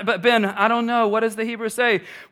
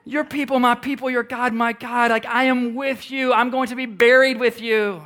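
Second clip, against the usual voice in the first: higher, rallying battle-cry declaration; Braveheart-style vow; 5 semitones higher than usual. Acted. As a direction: say it like a warrior's oath. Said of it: "man, Braveheart underneath and say".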